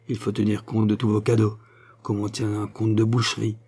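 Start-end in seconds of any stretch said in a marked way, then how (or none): none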